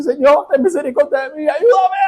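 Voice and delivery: high, strained begging cry to God; tearful plea. Retold or imitated. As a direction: plead high and near tears, like praying on the floor. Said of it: "empieza a llorar y quiere así".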